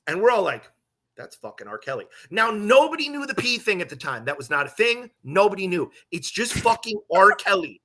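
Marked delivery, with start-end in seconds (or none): 1.2-2.1 very soft, low aside; hushed awe. Retold or imitated. Door crew spotting R. Kelly, hushed and awed. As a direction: drop it right down, star-struck but discreet.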